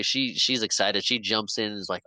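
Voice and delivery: ordinary talk, nothing marked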